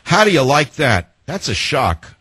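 mock-naive astonishment; sarcastic. Real, not acted. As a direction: feign shock with obvious irony. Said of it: "I'm just being sarcastic".

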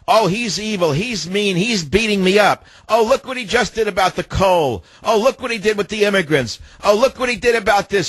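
high-pitched mocking whine, like a sulky baby; whining complaint. Host mocking conservative radio hosts whining like babies, high whiny imitation. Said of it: "whining and saying".

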